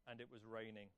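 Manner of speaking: soft, low, downbeat aside; glum reveal. Retold or imitated. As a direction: drop right down for the disappointing news. Glum reveal after pause.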